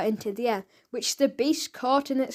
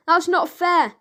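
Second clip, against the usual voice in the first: higher and louder protest; 6 semitones higher, 6 dB louder than usual. A narrator's reading. The boy protesting again, higher and louder. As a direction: object hotly. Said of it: "said Frank".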